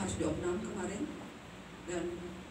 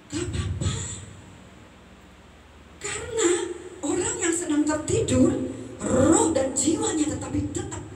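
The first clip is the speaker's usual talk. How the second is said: a hint of the sister, higher and bright-voiced; sister's voice; 12 semitones higher, 4 dB louder than usual. Widow retelling her sister's advice, lightly voiced quote. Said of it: "saya dengar dari adik saya".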